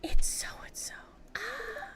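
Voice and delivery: put-on whispered kids' excitement; hushed kid gasping a name; excited kids. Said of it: "They were like".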